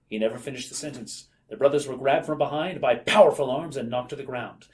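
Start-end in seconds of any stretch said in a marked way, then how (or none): none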